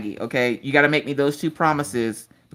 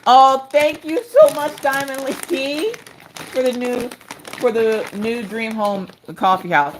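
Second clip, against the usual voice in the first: gushing thanks; 8 semitones higher, slower than usual. Real, not acted. Thanking a viewer's gift.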